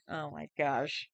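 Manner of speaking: normal talking voice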